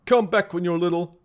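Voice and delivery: loud, high mimicry of a trader; mocking echo. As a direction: parrot the shopkeeper back mockingly. Mimicking the game trader's line.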